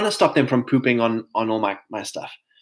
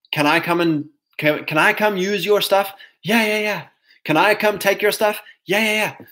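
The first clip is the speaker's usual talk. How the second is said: higher, eager yes-man voice; mock pushover dialogue; 6 semitones higher than usual. Mocking a kind man letting people take his stuff, higher eager voice. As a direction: play a cheerful doormat saying yes. Acting out a doormat exchange.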